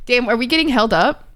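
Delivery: usual speaking voice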